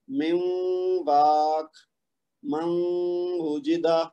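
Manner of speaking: من وجدہ with the noon merged into the waw; ادغام کے ساتھ; slow tajweed recitation. Shown as a demonstration. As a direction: recite each example slowly with ghunna. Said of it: "مثلاً پہلی مثال لے لیجئے".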